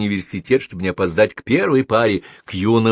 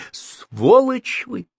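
furious; 14 semitones higher, much slower than usual. A narrator's reading. Professor curses policeman.

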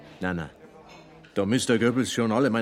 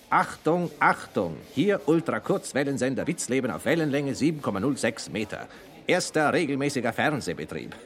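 mimicking an announcer; 5 semitones higher than usual. [name] imitates Hadamowski's first TV broadcast announcement. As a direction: bark it like a 1935 radio announcer. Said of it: "ich hab gesehen, wie der Hadamowski".

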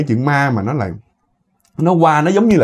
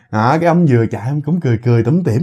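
ordinary delivery, nothing marked

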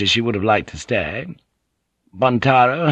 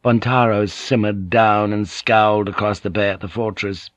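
plain reading voice

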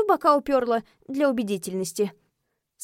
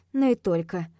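ordinary delivery

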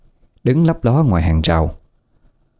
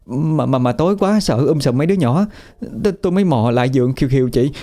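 much higher, fast and stammering, still shaken; frightened rush; 11 semitones higher, faster than usual. A narrator's reading. Frightened woman tells her sister-in-law about the ghosts, high breathless rush. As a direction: blurt it all out in one breath, scared stiff. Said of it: "rồi nói một hơi, kiểu như sợ ai giành nói".

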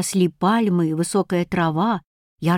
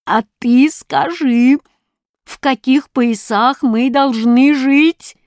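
slow, higher, insistent demand; drawn-out taunting demand; demanding challenge; 4 semitones higher, 4 dB louder, slower than usual. A narrator's reading. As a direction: press the question, stretching words. Captors challenge the hero.

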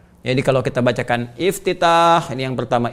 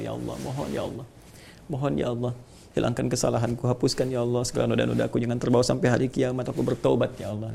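softer, faster whispered-like plea; hushed prayer; 6 dB quieter than usual. Shown as a demonstration. Teacher murmuring a repentance prayer, soft and intimate. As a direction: murmur the prayer quietly over the music. Quiet prayer over music.

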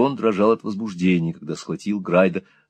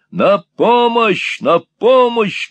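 much higher, louder, slow shout for help; 12 semitones higher, 5 dB louder, much slower than usual. A narrator's reading. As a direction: bellow it to scare the old man. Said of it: "крикнул Ральф".

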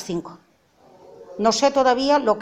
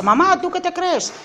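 high, loud retold yell; retold yelling son; 7 semitones higher, 6 dB louder than usual. Son yelling down the phone at his mother, high and loud. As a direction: shout it back like the son did. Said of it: "Entonces me grita".

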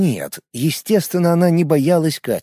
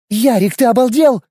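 hissing; 10 semitones higher, 4 dB louder than usual. A narrator's reading. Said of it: "зашипела фиолетоволосая".

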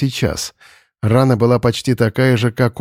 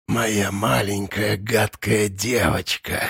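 lower, much softer, slow menacing hiss; hissing monster; 3 semitones lower, 8 dB quieter, slower than usual. Acted. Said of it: "прошипело существо".

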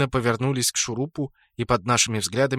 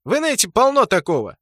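indignant protest; 9 semitones higher, 6 dB louder, faster than usual. Acted.

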